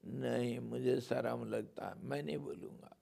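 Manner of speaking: put-on soft, shy child refusing; bashful refusal. Kanha refuses his mother, softly and shyly. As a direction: hang back, too shy to say it.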